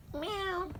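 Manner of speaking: high-pitched cat meow; mewing like a cat. Retold or imitated. Imitating the cat.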